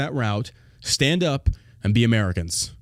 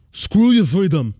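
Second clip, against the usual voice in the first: mock tough-guy quote; 4 semitones higher, 7 dB louder, slower than usual. Impersonating Schwarzenegger quote.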